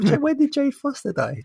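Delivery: high-pitched incredulous question; comic astonishment, voice shooting up; shocked disbelief. Retold or imitated. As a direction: act out baffled shock. Acts his shock at spoiler.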